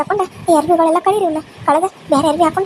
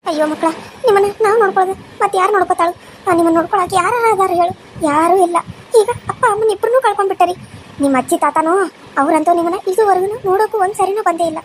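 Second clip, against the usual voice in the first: uncle. Acted. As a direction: comfort the orphans as their uncle. Uncle takes in the children. (ಮಮತೆಯ ಮಾವ)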